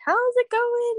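imitating a chirpy mom, very high pitch; high, perky retold greeting; all chipper. Mom greeting daughter, unaware of the fainting, chipper. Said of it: "like, all chipper".

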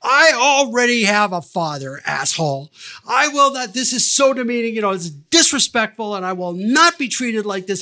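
replayed rant, high and heated; retold yelling. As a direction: let it rip, pitch climbing with outrage. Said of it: "it just yelled at him".

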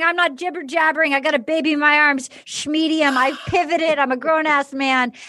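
fast run of catchphrases; rapid quote list. Retold or imitated. Host rattling off Ed's lines fast. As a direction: fire them off fast.

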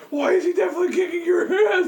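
high-pitched mock shout; pissed-off outrage. Everyone seeing the list online, pissed-off shouting. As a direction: yell it like an outraged commenter. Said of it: "get so fucking pissed off".

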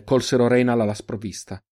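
ordinary delivery, nothing marked

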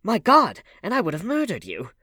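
high, very loud, aghast; horrified cry. A narrator's reading. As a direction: cry out in horror at what you nearly did. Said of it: "he cried".

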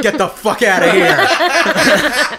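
loud, high, slow laughing outburst; stretched-out loud laughing "no way"; excited disbelief. Retold or imitated. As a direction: blurt it out giddy and disbelieving. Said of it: "And I was like".

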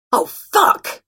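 loud, high outburst of panic; alarmed cry. A narrator's reading. Possum realising the cookers are on, alarmed cry. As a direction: cry out in sudden horror, pitch leaping. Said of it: "the possum cried".

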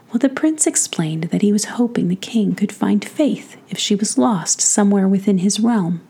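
ordinary delivery

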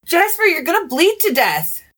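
high-pitched, acted alarm; worried, high exclamation; alarmed warning. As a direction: cry it out in dismay at the wound. Player's character warns wounded Jasper.

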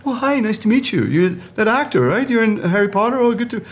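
high, gushing meet-and-greet voice; high-pitched, eager impression of a celebrity athlete; Milos Raonic. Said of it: "And Milos goes".

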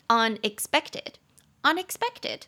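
unexpected said slowly and carefully twice; slow model word. Shown as a demonstration. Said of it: "大家可能要多念几次". (慢速示范unexpected)

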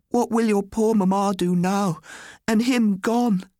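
high, sustained lament, slowing at the end; grieving cry. A narrator's reading. Martha laments the father's death to his son, wailing high. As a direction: keep wailing high, drawing out the last words. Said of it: "she cried".

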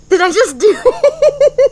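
high, slow, laughing disbelief; thrilled disbelief. Real, not acted. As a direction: squeal it out, amazed at yourself. Thrilled after saying sunsphere.

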